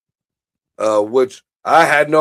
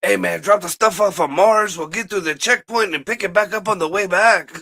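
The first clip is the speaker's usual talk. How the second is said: casual alien voice; 6 semitones higher than usual. Retold or imitated. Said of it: "I feel it's more like".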